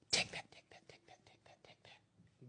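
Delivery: fading whisper of take that; whispered taunt. Real, not acted. Egging on [name]'s lawyers, whispered and fading. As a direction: hiss it under your breath, trailing away. Cheering [name] on under breath.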